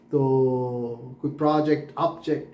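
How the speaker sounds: usual speaking voice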